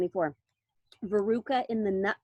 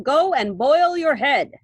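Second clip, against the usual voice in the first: dismissive retort; 4 semitones higher, 9 dB louder, slower than usual. A narrator's reading. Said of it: "said Mister Wonka".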